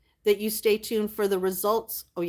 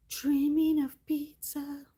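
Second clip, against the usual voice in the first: cooing; 7 semitones higher, slower than usual. Real, not acted. Cooing over a pet.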